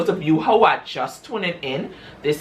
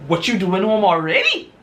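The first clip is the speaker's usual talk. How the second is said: a shifted, higher, caught-off-guard voice; caught cheater; 4 semitones higher than usual. Retold or imitated. Husband caught by his wife, feigning casual surprise. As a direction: play it cool though caught. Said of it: "Darren, being the man that he is, a dog".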